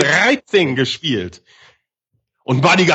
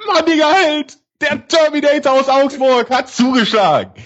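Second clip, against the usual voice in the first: excited commentary; 6 semitones higher than usual. Real, not acted. Repeated save call.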